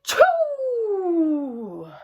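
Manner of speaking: loud high mock sneeze; bear sneeze. Bear sneezing from a pepper flake, loud mock sneeze. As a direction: let the sneeze burst out. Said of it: "makes the bear".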